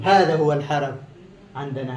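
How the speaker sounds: normal talking voice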